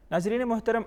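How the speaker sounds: ordinary talk, nothing marked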